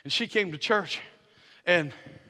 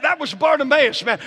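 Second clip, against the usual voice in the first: ordinary talk, nothing marked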